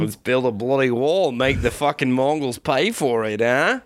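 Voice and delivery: put-on Australian bluster; Australian accent. Emperor of China plans wall, mock Australian accent. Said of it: "it's just all Australian".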